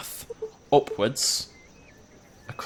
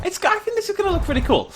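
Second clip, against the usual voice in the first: pitched way up with excitement; excited high voice; 3 dB louder than usual. Real, not acted. Building a dinosaur park, thrilled, high excited voice. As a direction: squeal it out, delighted.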